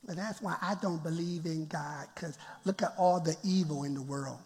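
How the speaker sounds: a hint of a grumbling skeptic.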